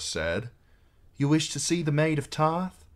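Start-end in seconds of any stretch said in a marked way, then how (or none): 1.2-2.8 higher-pitched, courteous query; formal young knight. A narrator's reading. Young knight asking his Lord Commander, formal. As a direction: report for duty in a lifted voice.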